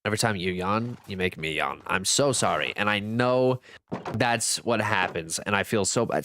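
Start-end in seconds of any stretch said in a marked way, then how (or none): none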